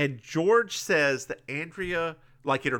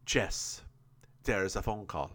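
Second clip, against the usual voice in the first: put-on lower, softer botched French-like accent; French-sounding accent; 6 semitones lower, 7 dB quieter than usual. Recapper voices Ramon announcing a call, accidental French accent. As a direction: attempt an accent that lands oddly French. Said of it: "yours somehow came out very French".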